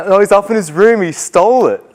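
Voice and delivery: lightly voiced tattling boy; excited boy. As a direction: tattle like a kid.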